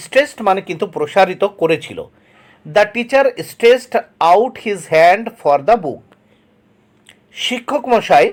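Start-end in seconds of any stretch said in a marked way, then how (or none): 2.7-6.0 very slow, word by word; slow dictation reading. Shown as a demonstration. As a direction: read it out slowly for the class to copy. Teacher reads example sentence aloud. (ধীরে ধীরে শ্রুতলিখনের মতো পড়া)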